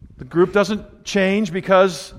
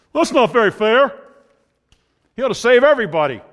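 higher, louder, fast indignant objection; mock complaining objector; 5 semitones higher, 4 dB louder than usual. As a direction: play the listener who finds God unfair. Preacher voices a complaining objector.

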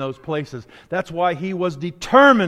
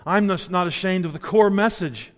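normal talking voice

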